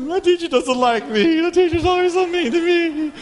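put-on high whiny kid voice; mock child whine. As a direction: play a sulky child complaining. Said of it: "little kids".

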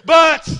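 "But," belted very high and loud; shouted BUT. Shown as a demonstration. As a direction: hammer the word "But," at the top of the voice. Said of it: "we need some more buts in church".

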